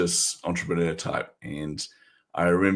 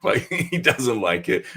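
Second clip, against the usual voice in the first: laughing; 7 semitones higher, slower than usual. Retold or imitated. Retelling his panicked inner voice.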